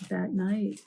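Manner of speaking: ordinary delivery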